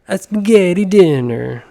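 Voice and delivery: exaggerated comic Italian accent. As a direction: belt it out in a bad Italian accent. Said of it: "I don't know if that's a good Italian".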